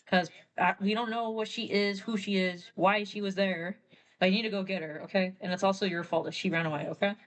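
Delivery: brisk, quick lecture to Hank; quick bossy telling-off; fast instructions. Retold or imitated. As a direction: rattle off the orders. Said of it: "tells Hank, like".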